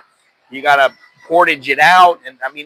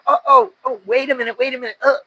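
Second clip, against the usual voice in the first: high-pitched dawning dismay, acted out; mock alarm; 8 semitones higher, faster than usual. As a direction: replay a small panic in a high voice. Retold alarm at a bodily accident.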